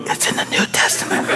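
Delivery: soft whisper to a neighbour; hushed aside in church; whispered tip. Retold or imitated. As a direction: lean in and whisper the help.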